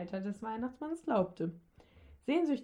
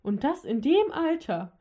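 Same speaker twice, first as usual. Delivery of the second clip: higher, louder shrewish jab; shrewish scolding; 5 semitones higher, 6 dB louder than usual. A narrator's reading. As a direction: throw a last spiteful jab, higher and louder. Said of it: "hörte der Weihnachtsmann die Dicke noch keifen". (keifend, höher und lauter)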